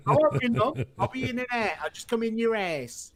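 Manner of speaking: high-pitched, broad Cockney storyteller; Mickey Flanagan. Retold or imitated. Retelling a comedian's joke, in a broad Cockney accent. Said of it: "has the best Cockney accent".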